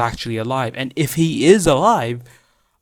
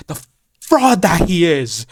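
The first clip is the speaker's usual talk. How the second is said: higher, louder, drawn-out denunciation; scornful outburst; 4 semitones higher, 5 dB louder, slower than usual. Pretend. Fan denouncing the replacement character, scornful outburst. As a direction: spit it out as a slow accusation.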